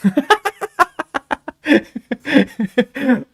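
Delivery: high, loud burst of laughter; big laugh. Real, not acted. Streamer laughs at his mom story, high and loud. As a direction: crack up at your own story.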